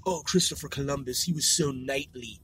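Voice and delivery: low, soft mocking admirer; hushed mock reverence; mock admiration.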